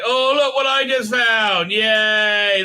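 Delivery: high, stretched-out cheer; mock gleeful discovery. Book shows what he just found, in hammed-up high glee. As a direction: gush with put-on glee.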